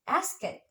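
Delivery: asket for ask, vowel put into the cluster; asket, as a learner says ask; added vowel. Shown as a demonstration. Student teacher imitating Indonesian learners saying ask, added vowel. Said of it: "they probably pronounce it like".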